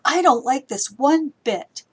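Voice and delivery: really mean that you don't like it; exclamatory, with energy. Shown as a demonstration. Teacher demos an exclamation with full energy. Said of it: "it just has a lot of energy".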